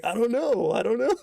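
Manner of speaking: high, giggling shrug; laughing friend. Retold or imitated. Friend answering the tripping storyteller, high and giggling. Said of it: "he started laughing too".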